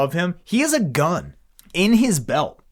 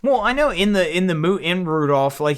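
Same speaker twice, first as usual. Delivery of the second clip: usual speaking voice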